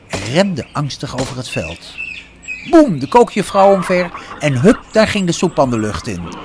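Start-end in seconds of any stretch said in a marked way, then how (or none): 2.7-6.3 louder, higher storytelling; animated, loud account of a commotion; excited narration. A narrator's reading. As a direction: burst out with the chaos. Narrating sudden chaos.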